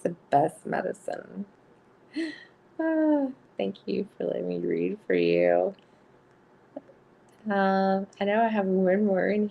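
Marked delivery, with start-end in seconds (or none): none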